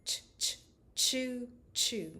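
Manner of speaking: whispered "Ch, ch" then a voiced "choo, choo". Shown as a demonstration. Therapist modelling ch into choo. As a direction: chug like a little train. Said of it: "choo sound".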